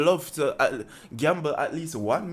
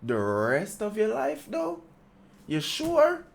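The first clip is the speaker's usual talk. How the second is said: skeptical; 5 semitones higher, slower than usual. Retold or imitated. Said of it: "looked at him and be like".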